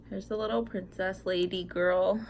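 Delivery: ordinary delivery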